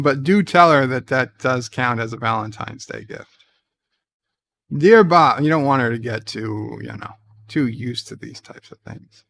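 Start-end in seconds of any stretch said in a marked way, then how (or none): 4.8-5.4 letter opener. Acted. Reading listener letters aloud.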